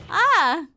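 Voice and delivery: loud, high cry of realization; startled "ah". Acted. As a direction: burst out with a high "ah". Character reacts to revelation.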